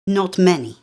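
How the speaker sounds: important air. A narrator's reading. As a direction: say it like she knows everything. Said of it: "Britta says with an important air".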